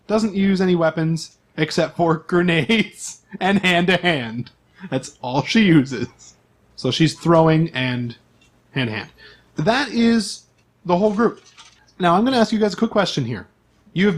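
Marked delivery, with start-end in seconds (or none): none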